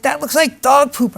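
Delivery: high, imitated dismay; upset son. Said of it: "how upset he was".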